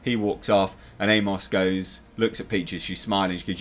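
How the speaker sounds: ordinary delivery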